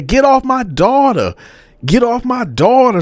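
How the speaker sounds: retold yell of the mother; mother shouting. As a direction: pull him off her daughter.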